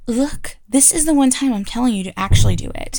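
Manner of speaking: firm command. Acted. Teacher turns suddenly commanding.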